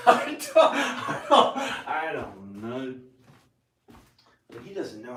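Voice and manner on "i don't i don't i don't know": high squealing laugh breaking through the words; can't get the words out for laughing. Real, not acted. To the camera, cracking up over Trump, laughing.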